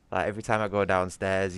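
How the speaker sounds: usual speaking voice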